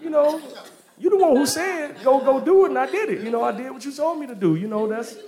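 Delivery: high-pitched, rapid comic protest; mock indignant defence. Abraham defending himself to Sarah about Hagar, high indignant protest.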